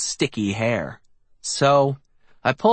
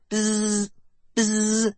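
high buzzing bee noise; 8 semitones higher than usual. A narrator's reading. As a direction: buzz like a bumblebee. Eddie buzzes like a bee.

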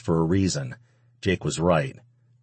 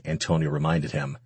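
plain reading voice

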